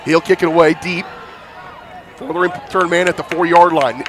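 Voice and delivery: usual speaking voice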